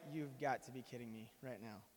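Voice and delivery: low, muted mock exasperation; deadpan disbelief. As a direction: play the dad sinking into quiet disbelief. Dad's acted deadpan reaction to son.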